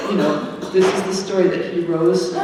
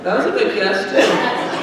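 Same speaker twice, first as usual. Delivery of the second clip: laughing through the words, a bit louder; 5 semitones higher, 4 dB louder than usual. Real, not acted. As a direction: chuckle while praising the guess. Laughing at a child's guess.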